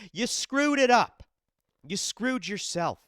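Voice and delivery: frustrated outburst at a fan favourite who blew it; exasperated yell. Real, not acted. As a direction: bark the blame at him, fed up. Scolding the absent fighter.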